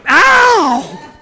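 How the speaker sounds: high loud ow; mock yelp of pain. Father whose hand hurt from spanking, mock yelp. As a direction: yelp like your hand stings.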